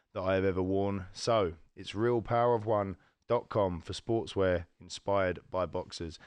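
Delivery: measured, carefully enunciated presenter delivery; sponsor ad read. Acted. Host thanking the sportswear sponsor, polished ad read. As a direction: read out a podcast sponsor message to listeners.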